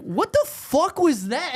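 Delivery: high, slow, appalled reenactment; mock disbelief. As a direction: stretch it out in stunned disbelief. His stunned reaction, retold.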